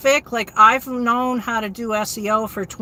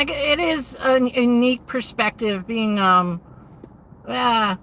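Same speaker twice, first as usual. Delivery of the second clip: ordinary talk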